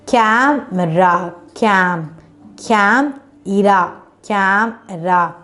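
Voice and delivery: "camera" drawn out slowly in separate syllables; syllable division. Shown as a demonstration. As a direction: sound out "camera" slowly, pausing after "Cam". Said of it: "We just divided into two syllable".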